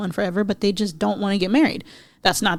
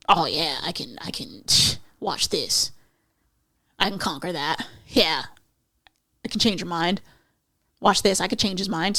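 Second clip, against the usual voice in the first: mock the smug schemer quietly; sly mock voice; 2 semitones lower, 4 dB quieter than usual. Mocking a scheming dater.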